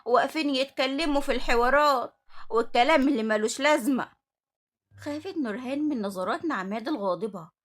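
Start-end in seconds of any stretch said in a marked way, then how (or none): 0.0-4.1 loud, angry telling-off of idle workers; scolding yell. Acted. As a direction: raise your voice and scold them. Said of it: "اتكلمت نعمات بزعيق وقالت".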